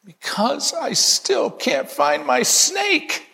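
put-on high, slow, anxious child's voice; frantic little boy. Boy's punchline in joke.

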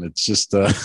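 ordinary delivery

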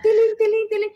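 imitando una campana, más aguda; tilín cantadito y agudo; campanita imitada. Retold or imitated. Imita la campana del basurero. (imitación aguda de la campana del burrero)

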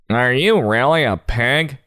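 high, lilting doubtful character voice; Petunia. Acted. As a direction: question it in a put-on pig voice. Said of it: "asks Petunia".

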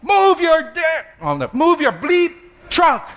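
high, loud imitated driver yelling; impatient yell. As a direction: mimic the honking lady shouting at the truck. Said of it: "and yelling".

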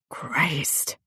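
whispered curse under the breath; a hushed, breathy oath; muttered. Acted. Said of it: "Jesse muttered".

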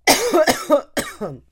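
loud choking cough; coughing fit. Real, not acted. Choking on coffee again.

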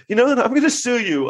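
lightly acted childish challenge; childish squabbling. Comic mocking a celebrity court fight, childish squabbling. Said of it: "the most child-, childish fight ever".

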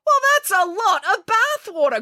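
high-pitched housekeeper's complaint; grumbling. A narrator's reading. Housekeeper faces a flooded floor, grumbling. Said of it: "grumbled the housekeeper".

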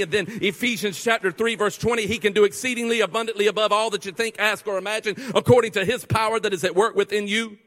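fiery preacher quoting Ephesians; preaching at full force. Acted. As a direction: proclaim it to the back row.

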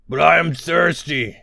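whiny ogre complaint; complaining. Acted. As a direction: grumble like a thirsty giant. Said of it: "complained the largest ogre".

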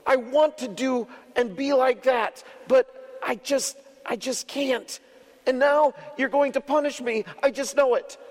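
high, distressed little goat voice; little goat crying out. Acted. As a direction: plead like a desperate small child. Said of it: "cried the little goat".

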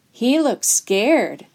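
slow, drawn-out mild taunt; understated schoolyard mockery; jeering. A narrator's reading. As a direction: jeer at a frightened kid, stretching the words. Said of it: "another boy jeered".

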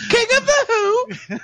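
very high comic British falsetto; Monty Python. Retold or imitated. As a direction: squawk it in absurd falsetto. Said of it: "he's basically Monty Python".

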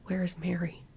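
soft, dawning worry; hushed dread. A narrator's reading. Mother suddenly missing her baby girl, hushed dread. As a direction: ask it quietly, almost to yourself.

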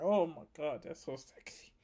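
whispered, no voice at all; breathless whisper. Pretend. Comic swooning over a woman grabbing his shirt, whispered. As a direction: hush it like a private thrill. Aside admiring grabbing girl.

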